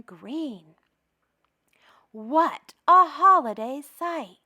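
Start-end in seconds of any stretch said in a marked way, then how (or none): none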